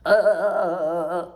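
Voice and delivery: high, loud stuttering uh sounds; mocking the rude guest's brain with dumb grunts; mock dumb grunting. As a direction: imitate an empty head going uh uh. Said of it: "this is what goes on in her brain".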